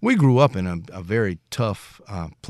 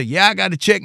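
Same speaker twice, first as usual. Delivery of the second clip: imitating dad; 9 semitones higher, 4 dB louder than usual. Dad voiced in retold story.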